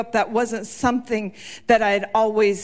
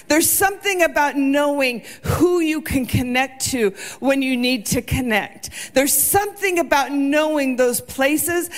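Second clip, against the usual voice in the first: preacher pressing the point home; rising preaching; 4 semitones higher than usual. Acted. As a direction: build the sermon with growing urgency. Preaching builds to full force.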